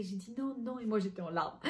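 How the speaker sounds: usual speaking voice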